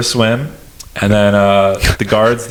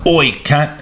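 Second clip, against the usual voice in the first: higher-pitched, put-on Aussie greeting; short burst of Aussie accent; Australian accent; 5 semitones higher than usual. Shown as a demonstration. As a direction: toss out a curt mock-Australian hail. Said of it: "I can do it with an Australian accent".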